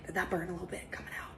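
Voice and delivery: quick soft whisper; hushed quick question. Real, not acted. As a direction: ask it in a hushed rush.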